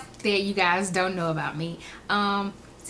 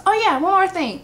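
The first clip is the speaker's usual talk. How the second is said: higher, louder sudden afterthought; lightly voiced manager; 10 semitones higher, 6 dB louder than usual. Imitating store manager turning back, lightly raised voice. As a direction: pipe up briskly, a touch mocking.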